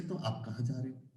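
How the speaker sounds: polite. Shown as a demonstration. Teacher contrasts polite with rude ways of asking, polite. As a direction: ask politely, soft and low. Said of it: "politely कह रहे हैं".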